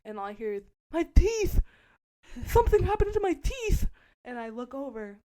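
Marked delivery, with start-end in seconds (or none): 0.9-3.9 very high, loud retold shriek; panicked scream. Candace discovering broken teeth, acted panicked scream. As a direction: shriek it in mock horror.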